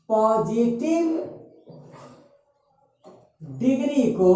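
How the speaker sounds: stretched syllables, long pauses between phrases; very slow, phrase-by-phrase dictation. Real, not acted. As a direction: dictate for students writing it down. Teacher dictating the rule slowly.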